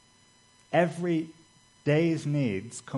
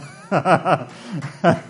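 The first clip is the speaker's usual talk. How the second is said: higher, louder burst of laughter; hearty run of ha-ha-ha; real laugh; 5 semitones higher, 8 dB louder than usual. Real, not acted. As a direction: laugh out loud at the anecdote. Laughs after telling the anecdote.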